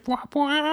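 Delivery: high sung trumpet wah wah; trumpet blast. Pretend. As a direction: sing the brass fanfare. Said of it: "trumpet blast from the theme song".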